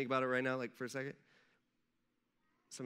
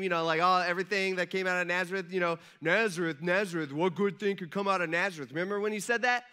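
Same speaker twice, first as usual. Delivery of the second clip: louder, animated ribbing; teasing Jesus; 6 dB louder than usual. Pretend. Jesus reminding Nathaniel of his jab, loud and teasing. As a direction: rib him playfully with a raised voice.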